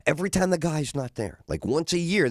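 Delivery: normal talking voice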